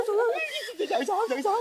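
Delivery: high, flustered laugh through the words; panicked laughing cry. Acted. Husband realizes she is in labour, high panicked laughing cry.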